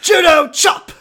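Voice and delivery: high, loud catchphrase yell; Austin Powers. Acted. Podcaster mocking a bad impression, hammed-up shout. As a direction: shout it like a goofy action move.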